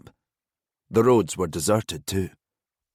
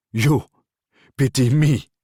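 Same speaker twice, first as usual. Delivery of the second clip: louder, higher, scoffing disbelief; incredulous scoff; 6 semitones higher, 6 dB louder than usual. Acted. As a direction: throw it back with a mocking laugh. Abdul laughs at pitying prisoner.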